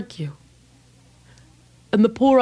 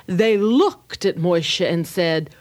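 ordinary delivery, nothing marked

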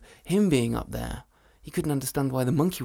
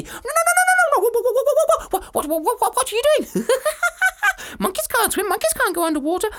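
very high, loud frantic monkey; panicked monkey; 8 dB louder, faster than usual. Acted. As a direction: shriek and laugh in panic.

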